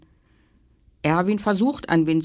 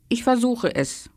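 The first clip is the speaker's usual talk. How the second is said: sighing; 5 semitones higher than usual. A narrator's reading. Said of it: "Erwin seufzt".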